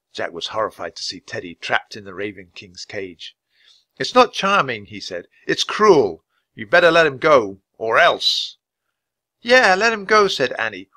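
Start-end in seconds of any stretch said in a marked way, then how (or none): 4.0-4.9 high, loud protest; boy's firm, raised-voice objection; indignant boy. A narrator's reading. Jack angrily challenges the king. 5.5-8.6 loud, higher-pitched warning; forceful boy's ultimatum; indignant boy. A narrator's reading. Jack angrily threatens the king. 9.4-10.3 high, loud demand; high-voiced little girl standing firm; defiant girl. A narrator's reading. Annie backs Jack's threat.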